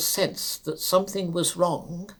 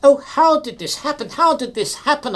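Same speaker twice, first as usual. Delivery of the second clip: distressed cry; 8 semitones higher, 5 dB louder than usual. Acted. Shocked at signalman's death.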